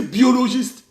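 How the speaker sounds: ordinary delivery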